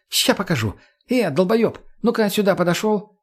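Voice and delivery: high, cheeky call to a dimwit; pushy, high-pitched woman's command; bossy summons. A narrator's reading. Margo summons the dim dead man.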